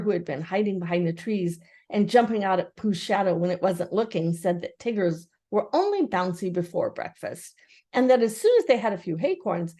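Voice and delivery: ordinary delivery, nothing marked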